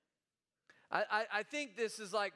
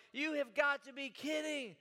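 higher, indignant scoff; scoffing disbelief; 6 semitones higher than usual. Retold or imitated. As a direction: scoff at the very idea. Peter voiced scoffing at Jesus.

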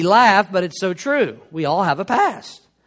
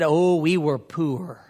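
slow, drawn-out Louisiana vowels; Louisiana accent; 3 semitones lower, 4 dB quieter, slower than usual. Retold or imitated. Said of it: "in her Louisiana accent".

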